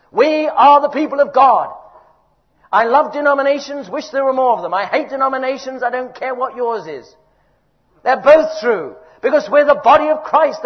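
loud, hammering each all; fervent preaching. Real, not acted. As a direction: lift the congregation with conviction. Preaching at full force.

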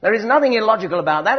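loud, high preaching over the congregation; booming sermon climax; full-force preaching. Real, not acted. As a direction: drive it home from the pulpit.

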